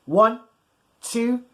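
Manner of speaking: usual speaking voice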